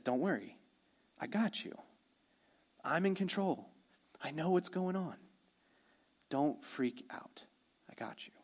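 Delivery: put-on calm a frightened child to sleep; tender hushed comfort. Preacher voicing Jesus comforting worried disciples, hushed and tender.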